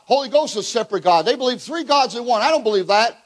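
ordinary talk

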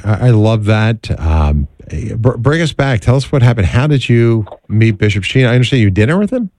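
ordinary talk

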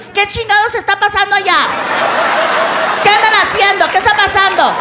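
high, alarmed housekeeping worker; freaked-out housekeeper. Retold or imitated. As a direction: demand answers in a high, frantic voice. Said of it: "housekeeping is freaking out".

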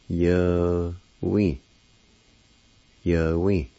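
"Yahweh" said as yo-wee, falling at the end; Cherokee word. Shown as a demonstration. Teacher repeating the Cherokee word for students, clearly. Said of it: "It's pronounced like this".

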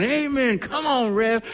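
high, drawn-out amen from the pews; Sandy. Retold or imitated. Congregant cheering the preacher on mid-sermon, calling out. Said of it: "it was always Sandy, you know, going".

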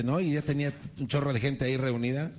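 usual speaking voice

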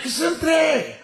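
put-on extremely high drunken squeak; high, slurred drunk tagging along; little voice from the back. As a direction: butt in with a piercingly high little voice. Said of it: "una vocecita".

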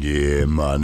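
slow, lightly put-on Jamaican greeting; Jamaican accent. Host mimicking how the traveller will talk, slow mock Jamaican accent. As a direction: stretch out Carlton in a mock Jamaican lilt. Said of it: "coming back speaking like this".

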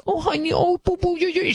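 high, mock baby-talk coo; cooing mom. Host mocking the Scarsdale mom soothing her son, high coo. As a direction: coo at him like a doting mother. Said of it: "the mom was like".

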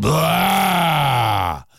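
loud mock bear roar; a big playful growling blah. A narrator's reading. Polar bears roar.

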